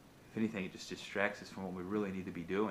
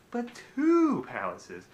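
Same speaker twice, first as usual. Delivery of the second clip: much higher and louder, slow, stretching two; king's gloating boast; 13 semitones higher, 9 dB louder, slower than usual. Acted. King gloating that he owns two palaces. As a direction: crow the word two like a greedy king.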